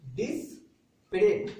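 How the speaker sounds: डिस्परेज drawn out very slowly, ending in a clear ट; slow careful pronunciation. Shown as a demonstration. Teacher showing the class the new word, slow and clear. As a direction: stretch डिस्परेज slowly and land on the final ट. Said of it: "जैसे हम बोले, देखिए".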